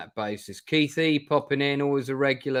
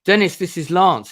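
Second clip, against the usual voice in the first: bright, loud eager greeting; excited introduction; 5 semitones higher, 9 dB louder than usual. Retold or imitated. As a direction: announce him happily. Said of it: "turns around and says".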